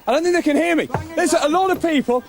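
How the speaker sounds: high, loud greeting over music; calling out at a crowded house; shouting over noise. Real, not acted. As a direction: make yourself heard over a party. Arrives at a noisy party.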